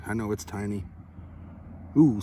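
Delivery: usual speaking voice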